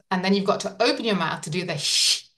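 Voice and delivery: ordinary talk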